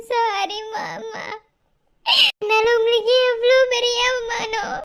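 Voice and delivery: crying as she apologises, words drawn out; tearful apology. Acted. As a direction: plead with uncle through tears. Niece tearfully apologising to uncle. (அழுதுகொண்டே மன்னிப்பு கேட்கும் குரல்)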